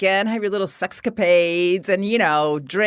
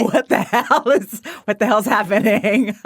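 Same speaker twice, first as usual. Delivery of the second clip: laughing disbelief; 3 semitones higher, 3 dB louder than usual. Retold or imitated. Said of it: "It's like".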